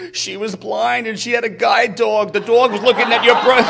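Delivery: high, loud, breaking into laughter; laughing hysterically. Retold or imitated. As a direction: explain through helpless laughter. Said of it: "she's laughing hysterically".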